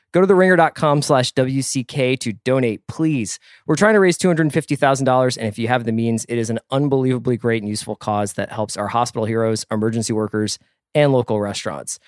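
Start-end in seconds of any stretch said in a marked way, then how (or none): none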